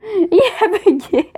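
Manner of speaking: laughing at a frozen game; giggling through the words. Real, not acted.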